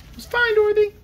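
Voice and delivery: very high squeaky piglet; piglet calling. A narrator's reading. Piglet reassuring Dorothy, high squeak. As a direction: call out in a tiny pig voice. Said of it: "called one of the piglets".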